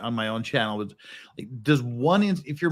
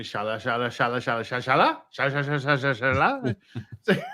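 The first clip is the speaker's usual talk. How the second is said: fake foreign language. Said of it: "Americans just sound like".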